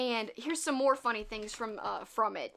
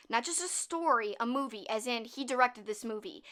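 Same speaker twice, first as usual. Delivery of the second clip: ordinary talk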